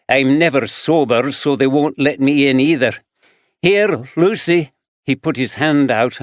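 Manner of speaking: lightly voiced rough Glaswegian man; gruff Glaswegian drifter answering; Glaswegian accent. Fraser rambling.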